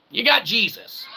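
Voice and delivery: ordinary talk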